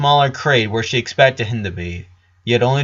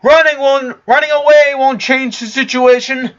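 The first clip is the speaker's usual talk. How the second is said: loud, high yell after him; 7 dB louder than usual. Acted. Flower yells after Richard as he runs over the roofs. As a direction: yell after someone fleeing. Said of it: "he heard her yelling behind him".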